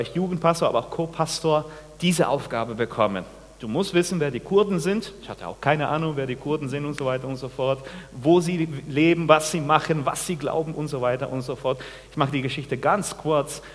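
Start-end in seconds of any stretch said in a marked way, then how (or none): none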